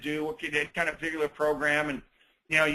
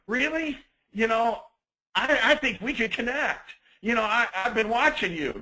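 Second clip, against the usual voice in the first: higher, overeager pickup line; creepy pushy guy; 6 semitones higher than usual. Acted. Man pressing a woman at the gym, creepy and pushy. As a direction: play the guy who won't take the hint. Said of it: "Now it's creepy".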